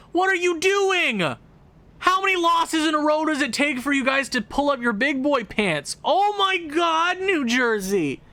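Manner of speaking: fed-up fan yelling at New Jersey in disbelief; exasperated yell. Real, not acted. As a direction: shout at the team on the screen.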